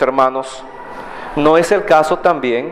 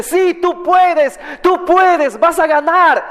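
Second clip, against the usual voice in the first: cheering fans; 10 semitones higher, slower than usual. Acted. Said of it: "una barra brava que le dice".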